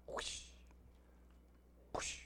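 short, soft mouth-made whoosh; whooshing sound effect. Pretend. Preacher filling in for failed sound effects, soft imitated whoosh. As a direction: blow out a quick hushed whoosh.

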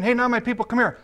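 high-pitched summons; brisk high call-over; calling by old nickname. Acted. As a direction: call the child over, voice lifted. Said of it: "So instead of saying".

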